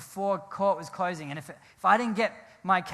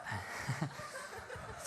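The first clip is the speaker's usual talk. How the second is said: soundless wheeze of a laugh; breathy silent laugh; 5 semitones lower, 14 dB quieter than usual. Real, not acted. Laughing at himself over the crash, a silent wheeze. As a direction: laugh without voice, just air.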